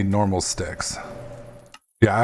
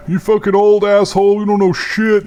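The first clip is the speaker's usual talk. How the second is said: much higher, louder mocking troll; 10 semitones higher, 8 dB louder than usual. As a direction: jeer like an angry internet troll. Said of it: "that's my new troll voice".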